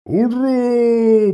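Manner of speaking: high shouted hurrah; cheering shout. A narrator's reading. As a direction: cheer it out from afar. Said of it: "послышалось от валов".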